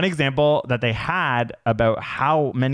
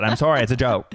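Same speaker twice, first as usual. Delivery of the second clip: ordinary talk, nothing marked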